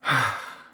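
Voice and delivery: long audible sigh; big breath out. Shown as a demonstration. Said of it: "Big breath in and out".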